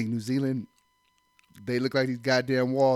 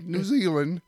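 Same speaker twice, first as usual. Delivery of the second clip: higher-pitched attempt at a New Zealand accent; imitated New Zealand accent; 5 semitones higher, 4 dB louder, slower than usual. Man quoting where she's from, mock New Zealand accent. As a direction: lightly mimic her New Zealand accent. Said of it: "'Cause she had an accent".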